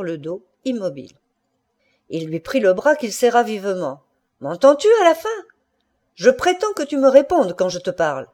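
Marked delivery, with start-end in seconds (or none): 4.4-8.3 raised, high-pitched angry demand; furious. A narrator's reading. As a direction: insist on being answered, voice raised. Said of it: "Il tremblait de fureur". (furieux, voix haussée)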